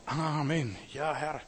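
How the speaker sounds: lifted, ready amen; affirming amen. Shown as a demonstration. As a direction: agree eagerly with God. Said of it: "dann musst du sagen".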